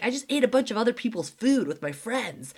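put-on bubbly little girl bragging; imitating her thrilled daughter; stoked kid. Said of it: "she was, like, so stoked".

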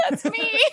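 high screechy laughter; shrill screeching laugh. Real, not acted. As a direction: shriek while laughing. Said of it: "the noise that just came out of her".

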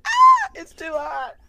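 high, slow whining; whiny pained voice. Retold or imitated. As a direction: squeal it like a hurt kid. Imitating friend burning on chips.

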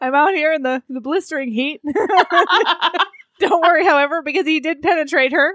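loud, laughing field reporter. Pretend. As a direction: deliver it like a live weather report. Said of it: "news reporter".

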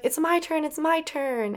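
imitated eager kids. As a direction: pipe up like a child wanting a turn. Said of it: "And the kids like".

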